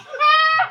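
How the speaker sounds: high, loud startled yell; real sharp shriek; sudden scream. Real, not acted. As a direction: scream out suddenly at full volume. Startled during gameplay.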